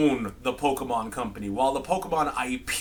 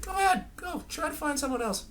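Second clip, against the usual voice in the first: mock taunt; 12 semitones higher, 5 dB quieter, faster than usual. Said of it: "You're just all like".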